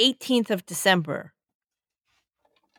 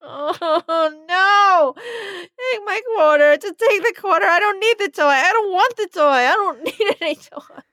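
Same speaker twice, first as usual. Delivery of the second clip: put-on whimpering child; 7 semitones higher than usual. Scared kid refusing puppet.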